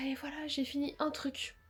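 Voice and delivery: whispered aside; a little whispered confession to the camera. Real, not acted. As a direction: drop to a whisper for this. Quiet aside while filming.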